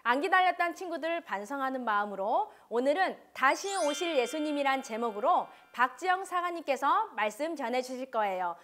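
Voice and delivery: ordinary talk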